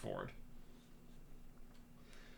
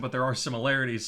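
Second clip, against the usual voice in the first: ordinary talk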